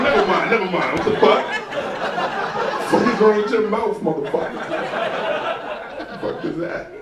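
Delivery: high, loud laughing disbelief; shocked outburst. Retold or imitated. Shocked by French reply.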